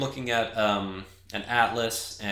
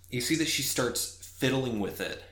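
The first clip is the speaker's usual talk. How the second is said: normal talking voice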